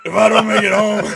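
loud, high, laughing through the line; hammed-up movie farewell, cracking up; dying soldier. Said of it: "break out the arm voice".

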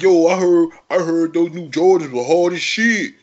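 high, loud Baltimore street voice; mock a Baltimore caller bragging about Jordans; Baltimore accent impression. Imitating a Baltimore caller in a loud Baltimore accent. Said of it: "It's like".

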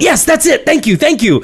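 high, relieved and thankful; relieved excited. Retold or imitated. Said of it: "And she went".

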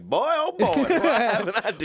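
high, loud, laughing cartoon voice; gleeful cartoon character squeal. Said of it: "he's like".

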